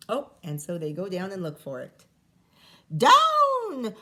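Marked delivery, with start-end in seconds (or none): 3.0-3.9 high, loud cry of "Down!"; cried out. A narrator's reading. Witch calling her cat to land, crying out. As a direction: call it out sharply to the sky. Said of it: "cried the witch".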